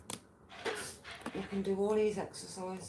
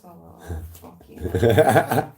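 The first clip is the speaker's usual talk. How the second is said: laughing groan; 7 semitones lower, 12 dB louder, much slower than usual. Real, not acted. Cracking up at own story.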